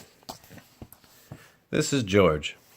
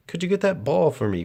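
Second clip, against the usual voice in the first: put-on small boy asking; 8 semitones higher than usual. A narrator's reading. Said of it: "he asked George".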